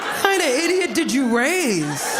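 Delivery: slow, high, laughing scorn; can't believe this mom, laughing; laughing disbelief. Real, not acted. To the viral mom: slow laughing scorn.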